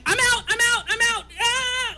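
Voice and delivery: high, frantic retold cry; high-pitched mock freak-out, voice jumping up; mock panicked exit. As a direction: act out bolting offstage in a panic. Retells fleeing the stage.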